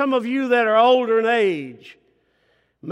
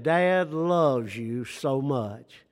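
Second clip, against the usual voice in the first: tender slow fatherly love; 5 semitones lower, much slower than usual. Retold or imitated. He changes the wording.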